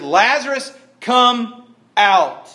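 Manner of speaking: high, loud, forceful command; authoritative yell. Acted. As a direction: summon him with a commanding shout. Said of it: "authoritative yell".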